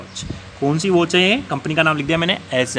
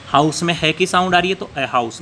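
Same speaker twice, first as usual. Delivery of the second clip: ordinary delivery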